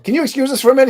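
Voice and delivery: high, tight, overly polite request; polite mock alarm. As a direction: squeak it out, embarrassed. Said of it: "I was like".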